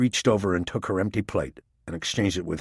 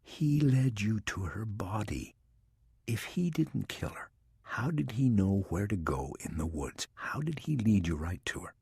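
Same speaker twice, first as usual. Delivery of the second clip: hushed; 7 dB quieter than usual. A narrator's reading. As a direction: keep it down, almost whispering. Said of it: "keeping her voice down so as not to disturb".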